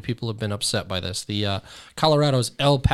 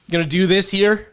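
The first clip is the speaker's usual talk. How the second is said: mock retort; 6 semitones higher, 7 dB louder than usual. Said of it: "I'm like".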